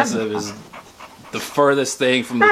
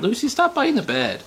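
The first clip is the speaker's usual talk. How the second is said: mock scolding the puppy; higher pet-voice scold; 12 semitones higher than usual. Real, not acted. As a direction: owner telling Lucy off in a higher voice.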